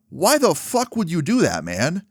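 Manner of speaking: high-pitched reenacted protest; indignant retort. Teller confronting man who threw a bottle, indignant. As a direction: demand an explanation, indignantly.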